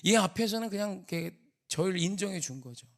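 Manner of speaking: ordinary talk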